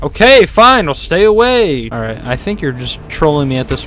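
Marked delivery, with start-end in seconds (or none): 0.0-1.9 raised, high-pitched exasperated surrender; exasperated giving in. Real, not acted. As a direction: give in loudly, fed up. Giving in to nagging game character.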